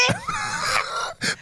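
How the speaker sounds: high shrieking burst of laughter; helpless high laugh. Real, not acted. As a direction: let out a high squealing laugh.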